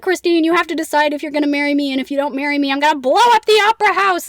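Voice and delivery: frantic, high and loud ultimatum; freaking out. Retold or imitated. Phantom giving Christine a marriage ultimatum, freaking out. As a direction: rush it out, building to a big threat. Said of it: "like, freaking out".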